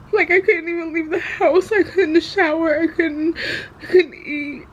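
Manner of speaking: fighting back tears while speaking; crying. Real, not acted. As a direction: voice breaking, tearful and high. Emotional vlog about grandma, anxiety.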